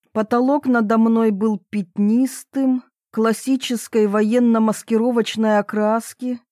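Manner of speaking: plain reading voice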